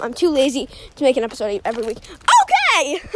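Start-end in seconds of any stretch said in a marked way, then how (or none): 2.0-3.2 sudden high squeal of okay, then a laugh; excited outburst. Real, not acted. Podcaster kicking off her marketing tip, giddy and high.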